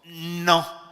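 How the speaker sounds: a loud, defiant shouted no; people shouting no. Acted. The people refusing Samuel, loud defiant shout. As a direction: throw the no back at God's messenger. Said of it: "Y dijo".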